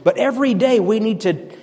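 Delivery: ordinary talk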